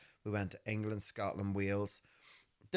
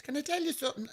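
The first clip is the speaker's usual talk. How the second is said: higher, blunt imitation of mum; faster than usual. Mum springing news without warning, lightly imitated. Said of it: "She just goes".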